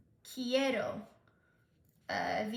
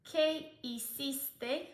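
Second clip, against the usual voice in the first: slow dictation; 3 semitones higher, much slower than usual. Shown as a demonstration. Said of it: "show off that I can ask a question".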